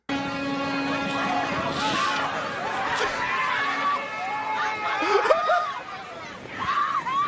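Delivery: high, helpless shrieking laugh; shrieking laughter. Real, not acted. Streamer losing it laughing at the crash. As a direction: break into a screaming laugh.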